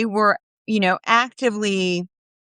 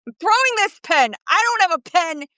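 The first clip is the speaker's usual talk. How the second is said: high, swooping New York rant; hammed-up outraged TV judge; Judge Jeanine Pirro; 7 semitones higher than usual. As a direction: yell it with a harsh accent and wild rhythm. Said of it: "that's my Judge Jeanine".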